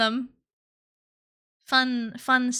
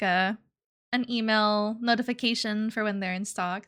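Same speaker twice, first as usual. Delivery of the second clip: normal talking voice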